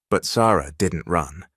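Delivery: plain reading voice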